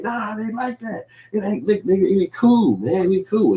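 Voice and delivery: hammed-up whining friend; whiny friend. The sulking friend protesting to the narrator, high and whiny.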